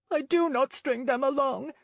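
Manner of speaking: a lightly voiced young lady, mumbled protest. A narrator's reading. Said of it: "she mumbled".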